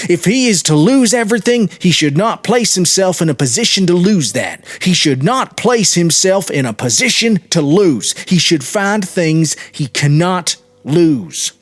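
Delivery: high, loud, bitter outburst; bitter loud tirade, slowing at the end; angry and bitter. A narrator's reading. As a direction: hurl the words bitterly. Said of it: "He spoke very angrily and bitterly".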